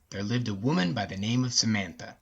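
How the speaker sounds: usual speaking voice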